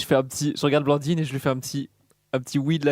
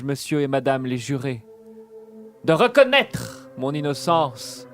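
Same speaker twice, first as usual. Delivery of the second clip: hammy stage actor. Acted. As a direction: declaim like a hammy tragedian. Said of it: "je vais déclamer un poème".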